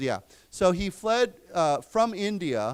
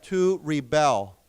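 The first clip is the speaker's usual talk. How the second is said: slow model of "rebel" as ree-BEL; stress on second syllable; 4 dB louder, slower than usual. Shown as a demonstration. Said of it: "the accent is different".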